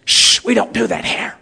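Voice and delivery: shushing church scold; mock disapproving hush; husher. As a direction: hush them with a hissed shush, then disapprove. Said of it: "Till he met the hushers".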